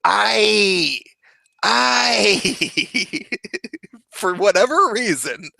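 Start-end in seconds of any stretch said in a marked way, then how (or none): 0.0-3.2 high, crying mock ay, ay; imitating a pained Spanish ay; Spanish speaker's ay. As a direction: wail ay like a hurt Spanish speaker. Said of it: "when a Spanish-speaking person gets hurt, they say".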